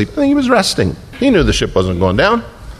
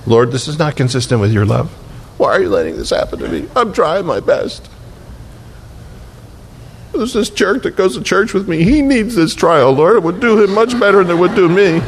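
mock complaining prayer; 6 semitones higher than usual.

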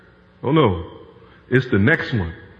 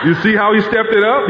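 ordinary delivery

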